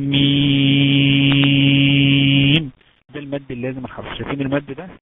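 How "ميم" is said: ميم with the ii held very long, much louder; letter madd demo. Shown as a demonstration. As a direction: recite ميم stretched six counts. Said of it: "ألف، لام،".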